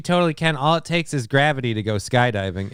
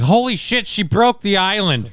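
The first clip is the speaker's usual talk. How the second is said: shocked; 8 semitones higher, 3 dB louder than usual. Pretend. Imagined shocked onlooker.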